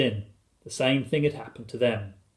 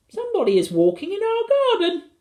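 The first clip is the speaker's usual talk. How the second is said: put-on very high, excited little boy; high-pitched childish alarm; Philip; 5 dB louder than usual. A narrator's reading. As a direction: report it in a piping child's voice. Boy's alarmed report.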